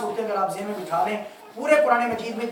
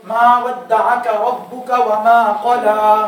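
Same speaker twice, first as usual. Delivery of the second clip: slow recitation, halting on "क़ला"; recited with a pause; 8 dB louder, much slower than usual. Shown as a demonstration. Teacher demonstrating a pause to students, slow recitation. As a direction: chant it slowly and stop on the last word. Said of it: "वक्फ कर रहा हूँ".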